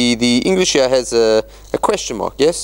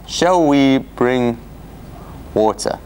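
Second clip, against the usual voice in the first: slow, weighing each word of "Shall we bring"; a slow, puzzled read with the voice dropping on "water"; slow probing reading; slower than usual. Shown as a demonstration. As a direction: read it slowly, testing the phrase. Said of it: "What is that?".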